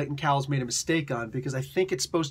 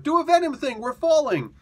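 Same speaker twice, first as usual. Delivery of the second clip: mock yelling; 11 semitones higher, 5 dB louder than usual. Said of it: "they're yelling at each other".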